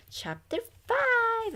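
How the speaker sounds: very high, slow announcement; announcing chapter. Real, not acted. Reader announcing the chapter to listeners, high and slow. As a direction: sing out the chapter title.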